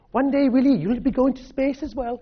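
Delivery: high, bright-eyed Scottish mum voice, lifted well above his own; mother's Scottish accent. Retold or imitated. His mother telling young Willie watching the moon landing, in a high Scottish accent. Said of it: "in her Scottish accent".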